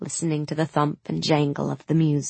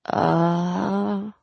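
hedging uh; 3 semitones higher than usual. A narrator's reading. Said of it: "Mal hedged".

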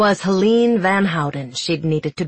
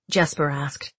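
ordinary delivery

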